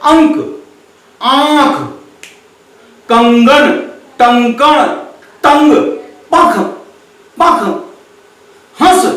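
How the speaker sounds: each word said on its own, nasals stressed; careful pronunciation. Shown as a demonstration. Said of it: "एक बार और उच्चारण पे ध्यान दीजिए".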